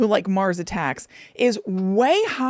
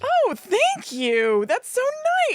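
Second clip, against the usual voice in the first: delighted; slower than usual. Real, not acted. Thanking co-host for a treat.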